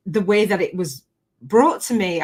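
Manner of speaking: usual speaking voice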